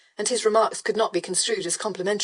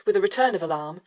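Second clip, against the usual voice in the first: plain reading voice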